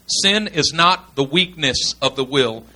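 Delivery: slow and deliberate, word by word; slow, emphatic repeat. Real, not acted. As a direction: spell out the key point slowly. Repeats slowly after "gotta get this.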